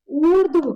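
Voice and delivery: ऊर्ध्व said loud, with dh running straight into v; correct pronunciation. Shown as a demonstration. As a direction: stress the long ऊ, no vowel between dh and v in ऊर्ध्व. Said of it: "गलत है".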